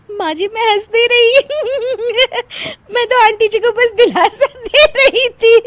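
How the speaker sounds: high denial shaken by giggles; laughing denial. Acted. Bride to mother-in-law, laughing while denying it. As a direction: protest between giggles. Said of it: "तू फिर से हंस दी।".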